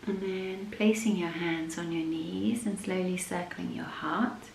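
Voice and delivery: soft, unhurried yoga nidra voice with long pauses; guided meditation. Acted. Leads a yoga nidra practice.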